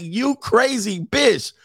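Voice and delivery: high, drawn-out mock yell, no real volume; imitated yelling. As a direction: mimic exasperated drivers yelling at her. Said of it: "they're yelling at her".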